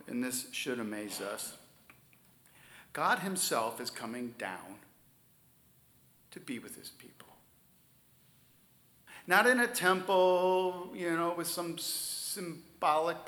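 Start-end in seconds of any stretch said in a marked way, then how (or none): 6.3-7.3 hushed whisper of awe. Real, not acted. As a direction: drop to a reverent whisper. Whispered climax of point.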